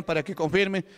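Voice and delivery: ordinary talk, nothing marked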